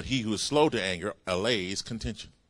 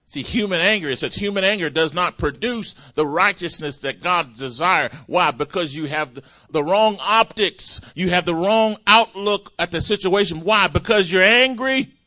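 full-force preaching; 8 semitones higher, 10 dB louder than usual. Acted. Preacher pressing the congregation on human anger, loud. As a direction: build it like a pulpit climax.

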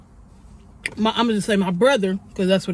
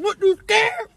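taunting; 9 semitones higher, slower than usual. Retold or imitated. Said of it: "My brother like".

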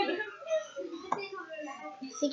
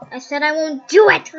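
much louder defiant repeat; louder insistent refusal; shouted refusal; 10 dB louder than usual. Acted. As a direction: yell it back defiantly.

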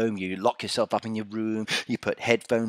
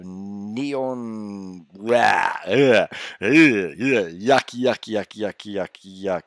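disgust; 5 dB louder, much slower than usual. Real, not acted. Disgust at door colour.

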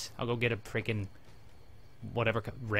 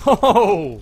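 startled cry; 10 semitones higher, 17 dB louder than usual. Real, not acted. Sudden exclamation.

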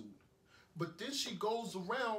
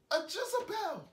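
very high-pitched accusing exclamation; exasperated denunciation; 3 dB louder than usual. Acted. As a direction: cry out the accusation, voice leaping up. Denounces his ex after breakup.

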